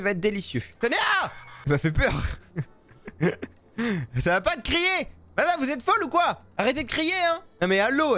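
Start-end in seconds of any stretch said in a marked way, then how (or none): 1.2-1.3 sudden high yelp of fright; jump-scare shriek; startled scream. Real, not acted. Seller startled handing over the shawarma, screaming. 4.2-8.2 high, rattled telling-off; indignant scolding. Real, not acted. Seller to screaming customer, indignant scolding.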